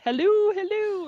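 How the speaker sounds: high, loud sing-song "Hello, hello"; host imitating the hunt call as hello; mock calling out. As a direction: call out like a hunter. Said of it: "I think it's like".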